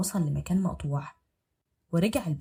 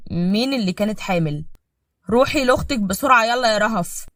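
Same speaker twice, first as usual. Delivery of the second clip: louder tense husband; stern demand; 3 semitones higher, 8 dB louder than usual. Acted. Husband demanding who was pregnant. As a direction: push for an answer.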